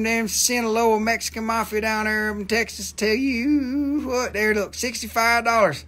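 hammed-up Texan cowboy, brash and rowdy; Texan cowboy accent. Live seller jokes to chat in a hammed-up Texan cowboy accent. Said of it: "you ain't heard no Texan cowboy accent".

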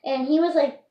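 normal talking voice

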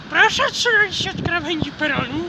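imitating a platform megaphone; megaphone announcement. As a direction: speak it like a station loudspeaker. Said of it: "bo jeszcze przez megafon".

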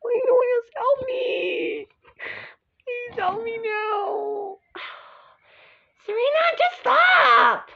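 high, drawn-out tearful plea; distressed wail. Acted. Girl begging someone to help her, distressed wail. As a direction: wail slowly for help, building to a cry.

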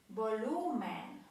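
slow Volumen, stressing the middle syllable; penultimate stress. Shown as a demonstration. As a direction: stretch the lu in Volumen. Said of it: "akcent właśnie pada na".

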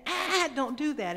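high, loud scolding with a nervous laugh; angry father scold. Retold or imitated. Father yelling at his son for coloring on the wall, angry. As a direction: snap at the boy high and loud.

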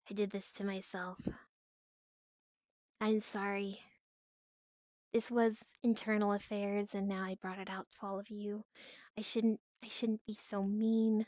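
low-key, quiet mumbled apology; sheepish regret. Pretend. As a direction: trail off softly as if guilty. Mock regretful confession before outburst.

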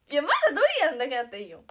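loud, fast, high outburst; exasperated protest. Real, not acted. Protesting to viewers about duck egg filling, exasperated outburst. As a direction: burst out in rapid protest.

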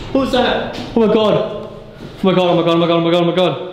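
high, panicked oh my Gods; startled by a bat, spiralling into panic; real fright. Real, not acted. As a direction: genuine scared squeals. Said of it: "I'm petrified of them".

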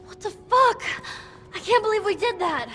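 high, upset disbelief; distraught outburst. Acted. Girl reacts to leaving the woman behind, distraught.

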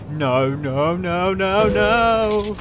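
rising panicked shouts of no; yelling as the crash comes. Real, not acted. Trucker about to crash, rising panicked shouts.

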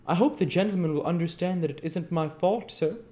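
high, quick, anxious pleading; pathetic whimpering. A narrator's reading. Missus Mann excusing herself to Bumble, whimpering. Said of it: "whimpering pathetically".

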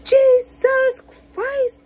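high, slow, drawn-out mock gasp of horror; exaggerated retelling of a friend's revolted cry; mock disgusted groan. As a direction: stretch it out like he's appalled.

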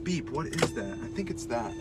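quiet aside muttered; mumbled under breath; muttering to self. Real, not acted. Car beep noticed while driving.

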